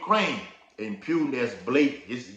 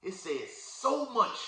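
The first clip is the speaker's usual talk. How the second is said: "so much" high and slow, falling; emphasis on so much; 9 semitones higher, much slower than usual. Shown as a demonstration. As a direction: stretch out "so much" with weight. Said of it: "with emphasis".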